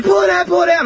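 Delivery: put-on high, frantic child's plea; panicked child. Injured little brother begging big brother, panicked high plea. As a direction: beg like a terrified little kid.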